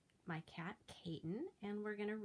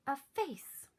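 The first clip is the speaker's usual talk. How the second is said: high, loud reveal; spring the surprise on them; dramatic reveal; 8 semitones higher, 9 dB louder than usual. A narrator's reading. Reader revealing the Yeti's face, high and loud.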